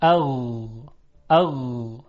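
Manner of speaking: اغ with the gh held on, uncut; soft continuous ghayn. Shown as a demonstration. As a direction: let the غ of اغ flow softly. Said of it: "و قطع نمیشه". (تلفظ اغ با غین نرم و کشیده)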